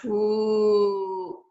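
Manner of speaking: a low drawn-out "Ooh"; low sound demo. Shown as a demonstration. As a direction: hum "Ooh" at the bottom of the voice to show a low wave. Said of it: "they're gonna go".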